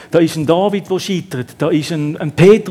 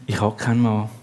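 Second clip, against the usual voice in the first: low, quiet, resigned admission; woman admitting quietly she has no husband; 5 semitones lower, 5 dB quieter than usual. Acted. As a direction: let it sink in defeat. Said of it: "ganz resigniert".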